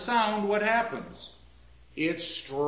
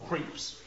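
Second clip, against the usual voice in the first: Creeps said quietly, breathy and weighted; accent on creeps; 7 dB quieter than usual. Shown as a demonstration. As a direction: lean into Creeps. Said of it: "first important word ... has to get an accent".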